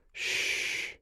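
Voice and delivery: soft breathy hush to calm her; comforting a panicked woman with a whispered hush; whispered shush. A narrator's reading. Soothing the frightened woman.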